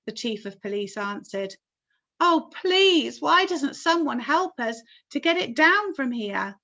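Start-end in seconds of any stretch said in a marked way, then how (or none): 2.2-6.5 high loud plea; pleading call. A narrator's reading. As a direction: call out for help. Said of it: "Miss Spider called out".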